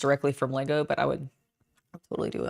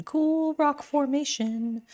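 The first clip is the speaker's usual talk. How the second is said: much higher and slow; high playful voice; 10 semitones higher, slower than usual. Shown as a demonstration. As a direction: lift it up high, savouring it.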